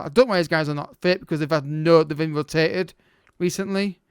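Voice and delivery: ordinary talk, nothing marked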